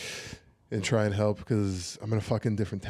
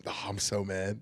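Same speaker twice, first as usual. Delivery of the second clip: ordinary talk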